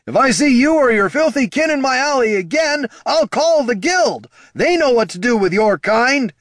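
high, loud, menacing threat; furious threat. Acted. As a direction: roar a threat at an intruder. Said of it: "bellowed a furious voice".

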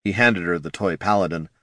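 ordinary delivery

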